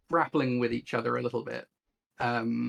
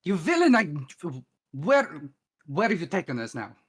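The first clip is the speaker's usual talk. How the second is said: high, loud opening burst that eases off; shouted accusation; 3 semitones higher, 3 dB louder than usual. Acted. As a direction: shout out at a villain, then drop to usual level. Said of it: "She shouts at the, at the man".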